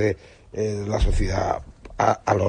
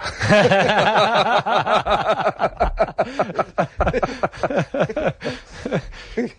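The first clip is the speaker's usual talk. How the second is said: high-pitched, sustained burst of laughter; long hearty laugh; 9 semitones higher than usual. Real, not acted. Teller laughing after his own joke, hearty laughter.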